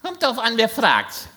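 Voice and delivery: high, mysterious seer voice; fortune teller. Acted. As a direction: tease them like a mystic. Wahrsagerin antwortet geheimnisvoll. (hohe, geheimnisvolle Wahrsagerin)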